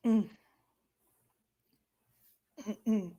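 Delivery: usual speaking voice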